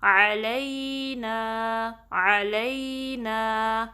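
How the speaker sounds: علینا drawn out with a two-count نا; very slow recitation. Shown as a demonstration. Teacher drilling the word for students. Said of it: "نا کو ٹو count stretch".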